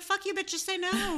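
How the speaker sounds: playful pretend cussing out; mock angry outburst. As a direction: fake fury at a polite refusal. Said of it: "And then I was like".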